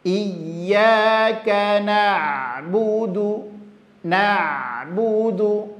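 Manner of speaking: slow, sustained Quran recitation; tilawah tartil. Shown as a demonstration. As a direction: recite with long held vowels and rolled r. (bacaan tartil, pelan dan panjang)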